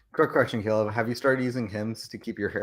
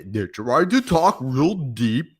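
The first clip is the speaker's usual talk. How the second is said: slow, louder strained throat deepness; throat deep voice; 5 semitones higher, 8 dB louder, much slower than usual. Shown as a demonstration. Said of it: "still trying to get the deepness with their throat".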